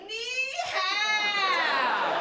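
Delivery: high shouted mock Chinese ni hao; mock Chinese greeting. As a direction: squeal ni hao in a put-on Chinese accent. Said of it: "She's walking around like".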